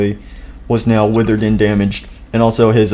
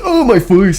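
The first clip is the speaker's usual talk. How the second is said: pained cry; 9 semitones higher than usual. Acted. Hit in the face.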